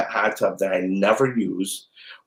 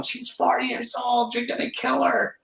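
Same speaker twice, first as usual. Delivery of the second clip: much higher, softer mock-horrified mom voice; scandalized moms; 14 semitones higher, 6 dB quieter than usual. Mocking the moms who judge her parenting, high and horrified. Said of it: "all these moms going".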